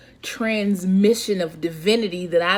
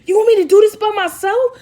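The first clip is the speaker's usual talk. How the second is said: high and louder, rising to a near-wail; crying out at God, much higher and louder; anguished cry to God; 13 semitones higher, 7 dB louder than usual. Retold or imitated. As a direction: build from questioning to a desperate cry. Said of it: "And then you".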